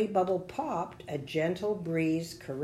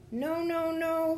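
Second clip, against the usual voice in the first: very high, tiny character voice; little bubble protesting; 12 semitones higher than usual. A narrator's reading. Bubble refuses in story.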